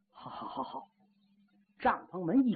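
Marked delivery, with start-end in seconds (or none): 0.1-0.9 whispered, groaning dismissal; faked weak groan. Wounded general waves the soldiers away, whispered groan. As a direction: breathe it out like a man in pain. Luo Lei feigning pain, accepts medicine.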